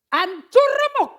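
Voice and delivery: high, loud and slow, crying out; slowly, shattered, from the top of the voice; broken cry. Acted. Preacher dramatizes Paul broken.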